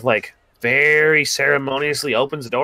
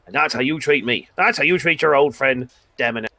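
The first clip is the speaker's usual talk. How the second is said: mock-hurt Demonarin; 3 semitones higher than usual. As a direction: play up the injured pride to the elf. Demon mock-offended at elf.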